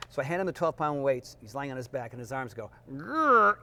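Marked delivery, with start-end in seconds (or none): 2.9-3.6 high, louder strained growl of effort; straining grunt. Retold or imitated. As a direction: grunt like arms giving out under weight. Arms straining with dumbbells.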